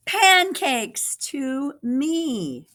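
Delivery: put-on high, slow, drawn-out boy's voice; little boy's answer. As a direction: savour the answer slowly, proud. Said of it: "said a little boy".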